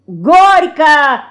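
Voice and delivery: loud, high ringing call; boyish shout. A narrator's reading. Лёня shouts behind the girls' backs, loudly. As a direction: shout it gleefully to interrupt. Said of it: "крикнул за спиной подруг".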